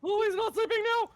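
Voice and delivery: panicked shouting. Real, not acted. Panicked yelling at game boss.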